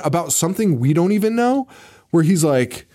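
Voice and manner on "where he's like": ordinary talk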